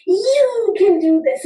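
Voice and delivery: slow, high encouraging push. Retold or imitated. Friend urging her at the party, slow encouraging voice. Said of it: "she says".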